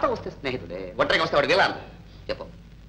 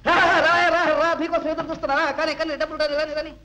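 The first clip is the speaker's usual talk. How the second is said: eager welcome; 3 semitones higher than usual. Acted. Broke man greeting the awaited postman, eager excited welcome. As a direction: urge him in with rapid repeated calls.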